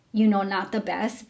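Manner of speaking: usual speaking voice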